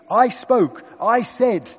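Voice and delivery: I punched high and loud in "I spoke, I said"; emphatic quote. Shown as a demonstration. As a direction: hit each "I" hard and high. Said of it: "emphasizing that John the Baptist".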